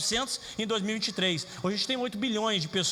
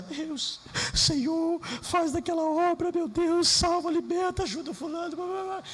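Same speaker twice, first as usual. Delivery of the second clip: lightly voiced, higher pleading prayer; mock-pleading prayer, higher; imitating his prayer; 7 semitones higher, slower than usual. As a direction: rattle off a routine prayer. Retells his own prayer.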